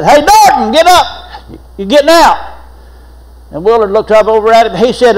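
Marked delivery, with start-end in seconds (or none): 0.0-2.6 high, loud call down the cell block; jailer hollering. Retold or imitated. Jailer calling a prisoner down the block, loud and high. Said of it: "the jailer hollered down there".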